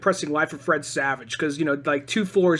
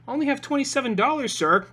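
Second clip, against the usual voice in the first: put-on high, quick kid voice; lightly voiced timid customer; imitated polite kid; 7 semitones higher than usual. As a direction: play a kid politely asking. Voicing kid at ticket counter.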